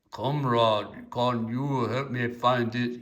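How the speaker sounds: loud, hammed-up Russian accent; badly faked Russian, calling out for help; terrible Russian accent. Newly landed Russian asking someone for guidance, in a terrible Russian accent. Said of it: "I'm doing a terrible accent".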